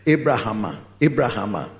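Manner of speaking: Abrahama said as ay-bra-HAH-ma, low and falling; local pronunciation. Shown as a demonstration. Preacher teaching the congregation to say Abrahama. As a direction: say Abrahama with a long open middle a. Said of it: "Or Abrahama".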